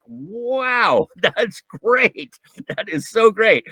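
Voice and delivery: high, laughing burst of delight; delighted wow. Retold or imitated. Remembering his first reaction to the idea, high and delighted. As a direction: gush over it like a revelation. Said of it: "I was like".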